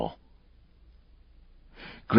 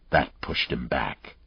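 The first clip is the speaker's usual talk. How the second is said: plain reading voice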